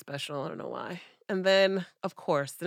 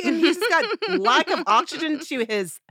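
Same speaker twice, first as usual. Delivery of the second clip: loud, high mocking rant; loud, high mockery of the ex; excited rant; 10 semitones higher, 7 dB louder than usual. Real, not acted. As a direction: rant about him with a laugh in it. Ranting aside about ex.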